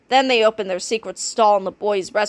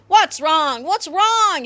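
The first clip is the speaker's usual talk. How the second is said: yell; 7 semitones higher, much slower than usual. A narrator's reading. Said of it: "yelled Mister Krupp".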